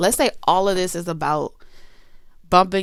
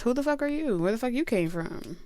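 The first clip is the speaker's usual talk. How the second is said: suspicious; 6 semitones higher than usual. Acted. Regular questions the newcomer.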